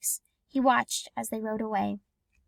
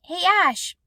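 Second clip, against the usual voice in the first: higher and louder call; shout; 6 semitones higher, 6 dB louder than usual. Acted. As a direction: shout across the empty field. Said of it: "he shouted".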